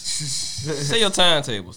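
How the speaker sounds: usual speaking voice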